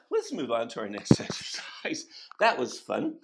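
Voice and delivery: ordinary talk, nothing marked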